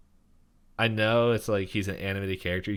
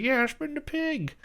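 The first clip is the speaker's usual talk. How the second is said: taunting crowd. Retold or imitated.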